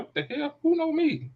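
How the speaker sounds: high startled what the hell; retold shock at hearing her name; surprised. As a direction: spin round caught off guard, voice jumping up. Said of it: "I turned around like".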